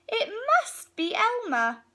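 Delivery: high, loud delighted cry; excited realisation. Acted. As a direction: announce the discovery with glee. Elephants realise it is Elmer.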